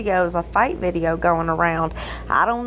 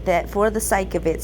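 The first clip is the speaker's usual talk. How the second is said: usual speaking voice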